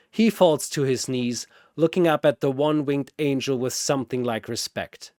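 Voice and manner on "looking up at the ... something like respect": usual speaking voice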